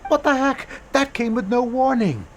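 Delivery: shocked disbelief. Acted. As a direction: pitch jumps high in sudden disbelief. Shocked by theater closure news.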